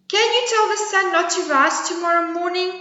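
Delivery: high squeaky squirrel voice; little squirrel. Acted. Squirrel questioning the bragging bear, high squeaky voice. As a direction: pipe it up small and high.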